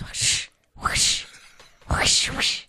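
voiceless mouth-made swishes; imitated whoosh noises. Recapping SpongeBob walking cautiously, imitated whooshes. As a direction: separate hushed "whsh" sound effects.

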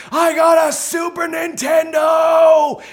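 put-on very high, loud childish scream. Boy on Christmas morning screaming out to God, thrilled. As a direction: shriek it out like a thrilled kid. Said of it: "I screamed out to God".

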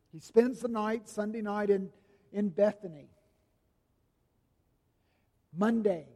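usual speaking voice

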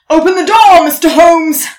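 loud commanding shout; guards shouting. Acted. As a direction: bang on the door with your voice. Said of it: "It was the Queen's guards".

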